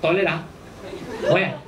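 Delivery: terse Singlish question; choppy Singlish, particle and all. Shown as a demonstration. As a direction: put on a blunt Singaporean accent. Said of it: "You're gonna have to say".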